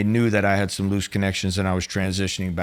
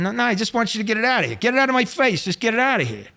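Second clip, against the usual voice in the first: high, fast mock shouting at the waitress; a retold mobster yelling at the waitress; hair-trigger tantrum; 12 semitones higher, faster than usual. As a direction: snap at her to take it away, imitated. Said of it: "making a scene".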